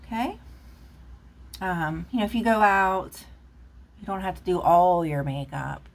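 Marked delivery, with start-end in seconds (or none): none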